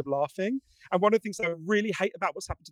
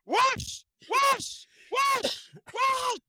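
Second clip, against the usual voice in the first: very high squealing wash wash, half shouted; shrieked gushing wash; much slower than usual. Retold or imitated. [name] mimics water gushing out.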